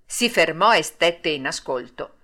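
usual speaking voice

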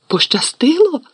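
high, loud disbelieving squeal; indignant shriek. A narrator's reading. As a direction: shriek it back in outrage. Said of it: "заверещала Герміона".

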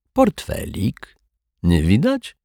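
high, drawn-out mock-innocent questions; teasing. A narrator's reading. Rogue teases the detective over the wallet, teasing. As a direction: toy with them, slowly and high.

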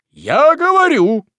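very high, loud slow declaration; high loud righteous opening; noble anger. A narrator's reading. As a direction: seize the floor with indignation. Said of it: "пылая благородным гневом".

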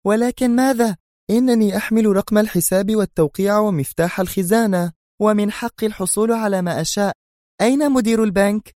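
indignant high-pitched outburst; furious. A narrator's reading. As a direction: cut him off in a rage. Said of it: "قاطعته في ثورة".

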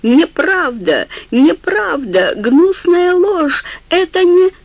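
rising, slow hysterical scream; hysterical shout. A narrator's reading. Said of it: "истерично закричал он".

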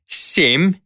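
counting down a life; demon counting. A narrator's reading.

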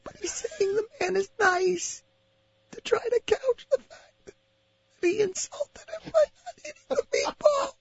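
high-pitched laughing wildlife narrator; nature documentary. Pretend. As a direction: gush like a nature host, cracking up.

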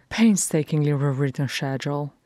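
usual speaking voice

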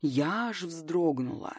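plain reading voice

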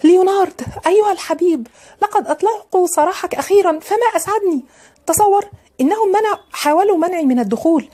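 put-on high-pitched, gushing young sweetheart; overjoyed girl calling to her beloved; excited cry. A narrator's reading. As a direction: cry out with eager relief, pitched well up. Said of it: "وقالت وهي تصيح".

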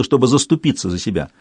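plain reading voice

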